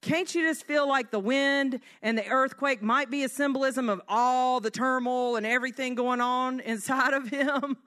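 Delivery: ordinary talk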